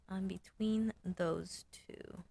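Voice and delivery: hushed slow whisper. Real, not acted. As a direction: whisper it to yourself. Repeats it whispered.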